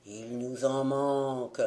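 low, hollow ghostly chant; sepulchral voice. A narrator's reading. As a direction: intone it like drowned sailors from the grave. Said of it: "d'une voix sépulcrale". (voix sépulcrale, grave et creuse)

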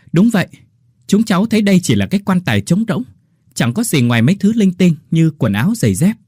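loud, high, fast call up from the grave; shouting loudly. Acted. Diggers shout up from the grave, loud and fast. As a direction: shout it up so everyone hears. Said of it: "trả lời thật lớn".

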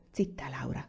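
low, soft reprimand; hushing. A narrator's reading. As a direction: hush the child quietly and firmly. Mother hushes her crying daughter.

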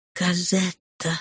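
soft, slow, faint; weak and trembling. Acted. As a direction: barely get the name out. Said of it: "дрожащие от слабости".

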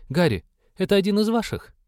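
put-on a scrappy elf girl asking. A narrator's reading. Half-elf asks the cleric.

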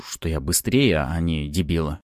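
ordinary delivery, nothing marked

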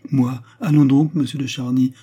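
high, loud scoffing retort; indignant protest. A narrator's reading. Queen to Charny who suspects her, indignant protest. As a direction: throw the suggestion back, loud and high.

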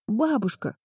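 put-on girl's glad cry of recognition; joyful exclamation. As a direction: call out to grandma with relief. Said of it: "воскликнула Флис".

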